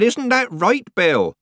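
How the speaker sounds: high, slow, loud repeat. A narrator's reading. Nanny repeats her question to old Bill, raising her voice. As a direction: spell it out loudly and high. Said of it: "raising her voice".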